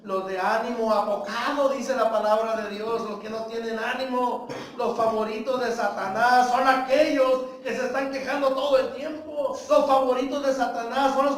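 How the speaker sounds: fiery pulpit delivery; full-force preaching. Acted. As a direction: preach it hard to the congregation. Preaching opening rebuke.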